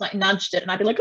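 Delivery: ordinary talk, nothing marked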